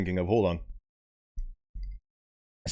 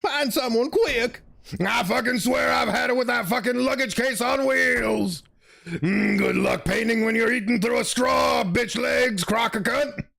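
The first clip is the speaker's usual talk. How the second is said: furious shouting; 10 semitones higher than usual. Acted. Raging character voice.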